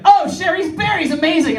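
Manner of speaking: high-pitched imitated gushing; excited coworkers. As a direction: gush over a treat in mock delight. Coworkers admiring the berries.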